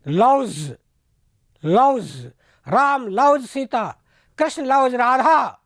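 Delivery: लव्स ending in a buzzing z, not s; z-ending plural. Shown as a demonstration. Teacher showing लव्स with a z sound for the plural. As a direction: finish लव्स with a voiced z. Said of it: "एस नहीं रह करके ज हो जाता है".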